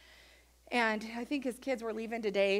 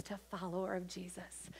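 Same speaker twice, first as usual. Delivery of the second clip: whispered; 4 semitones lower, 11 dB quieter than usual. Real, not acted. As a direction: breath-only hush. Whispered sermon line.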